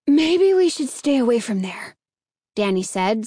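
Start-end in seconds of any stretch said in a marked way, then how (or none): none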